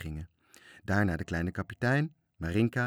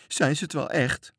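high, raised anxious voice; timid, scared question; 7 semitones higher, 5 dB louder than usual. A narrator's reading. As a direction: ask it fearfully, pitched up high. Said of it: "vroeg Toontje bang".

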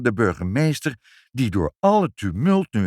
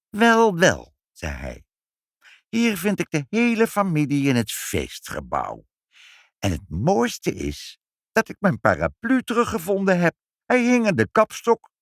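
smug; 8 semitones higher, 4 dB louder than usual. A narrator's reading. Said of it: "een heel zelfvoldane grijns".

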